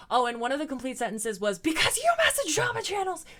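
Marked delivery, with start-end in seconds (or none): 1.6-3.3 high, loud mock shriek; Shane's ranting crash-out. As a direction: imitate an outraged rant, pitched way up and louder. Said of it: "he just ranted the entire time".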